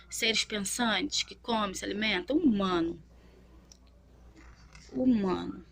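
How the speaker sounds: usual speaking voice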